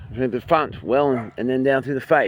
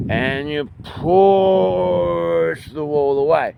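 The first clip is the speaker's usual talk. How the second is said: very slow, higher strained push; slower push; 4 semitones higher, 3 dB louder, much slower than usual. Shown as a demonstration. Teacher repeating the wall push, slower and higher. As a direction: draw it out fully as you press. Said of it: "demonstrating pushing motion".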